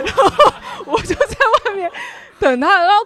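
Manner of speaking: laughing through the words, slowly; a laugh breaks out mid-story. Real, not acted. Laughing while telling friends the story.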